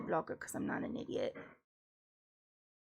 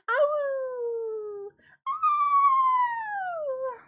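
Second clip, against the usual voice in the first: high yelps of "Ow!" that jump up; a pained yelp at the very top of the range; shrieked "Ow!"; 6 dB louder than usual. Real, not acted. Voice cracks on high siren.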